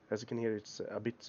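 usual speaking voice

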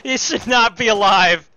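loud slow disbelieving yell; shout. Real, not acted. Enemy survives.

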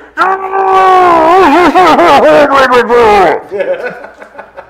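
long, loud, high-pitched howl; huge in-character scream breaking up at the end; acted wild scream. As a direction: let out a drawn-out shrieking cry. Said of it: "all you hear is".

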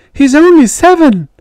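high, loud, desperate shriek; mother shrieking at the father. Retold or imitated. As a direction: scream it to stop the beating. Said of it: "shrieking at him".

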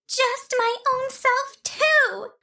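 very high tiny fairy voice, wailing; a tiny fairy child wailing about her burned foot. A narrator's reading. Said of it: "she cried".

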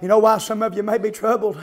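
ordinary talk

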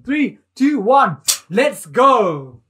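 loud, slow drawn-out countdown. Shown as a demonstration. Director of the maths lesson counts in the start, loud and slow. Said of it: "I'm the director of your maths lesson".

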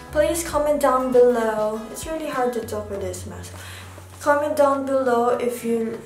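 ordinary delivery

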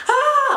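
high, loud "ah" scream; re-enacted full scream. Mother shows how she screamed in labour, loud high scream. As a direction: let out a sudden high scream. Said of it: "I was like, full screaming".

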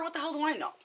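high-pitched exasperated retort; acted exasperation. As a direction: throw the question up high, exasperated. Exasperated retort in retold rant.

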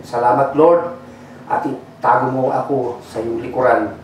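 low, soft and hushed prayer voice. Acted. Pastor praying over the congregation, hushed and low. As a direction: pray softly over the church. Pastor prays before the sermon.

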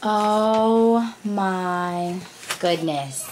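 very slow, drawn-out astonishment; stunned, lingering delivery; amazed. Real, not acted. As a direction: stretch every word in wonder. Stunned by the last gift.

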